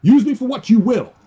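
high, pleading surrender; fervent prayer. Retold or imitated. Believer praying to the Lord, high and fervent. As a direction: beg him to take you. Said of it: "by saying".

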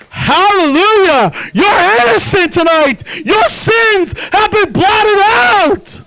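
high, loud, drawn-out proclamation; jubilant preaching shout. Real, not acted. Preacher declaring sinners innocent tonight, jubilant shout. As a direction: shout it out like a triumphant verdict.

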